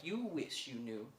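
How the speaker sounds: low, slow, hushed retort; quiet, knowing aside. Retold or imitated. Muddy customer answering staring shop workers, quiet and knowing. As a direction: say it softly, drawn out, as if weighed down.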